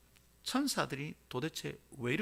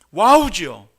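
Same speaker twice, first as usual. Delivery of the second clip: ordinary delivery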